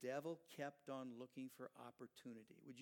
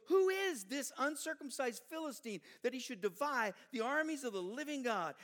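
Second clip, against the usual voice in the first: defiant challenge; 10 semitones higher, 11 dB louder than usual. Acted. David defies the Philistine.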